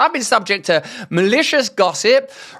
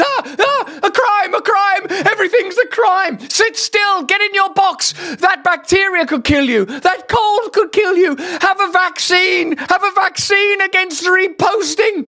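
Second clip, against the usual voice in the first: much higher, frantic mocking voice with laughs; shrill mock panic; 9 semitones higher than usual. Host mocking the state as a hysterical nanny, high mock panic.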